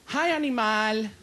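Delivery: slow, drawn-out camp greeting; put-on camp voice, stretching the words. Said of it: "Why are you talking like that?".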